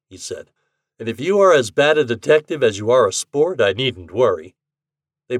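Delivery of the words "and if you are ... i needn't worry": a lightly voiced brash young gambler, louder; slightly louder, easy-going voice for Ashton; a hint of a cocky bettor. A narrator's reading. Ashton teasing in dialogue.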